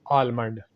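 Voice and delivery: आलमंड with the l sounded, aal-mand; wrong pronunciation. Shown as a demonstration. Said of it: "जो कि गलत है".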